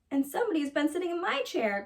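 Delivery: mama bear voice. A narrator's reading. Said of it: "called Mama Honey Bear in her middle-sized voice".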